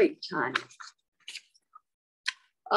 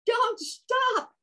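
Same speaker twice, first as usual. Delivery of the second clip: very high, thrilled squeal; high gleeful frog shout; excited frog cry; much slower than usual. Acted. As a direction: egg the dancers on, delighted. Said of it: "Frog cried".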